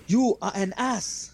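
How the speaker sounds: hammed-up British kitchen insult; mock British chef. Listener imitates the chef too.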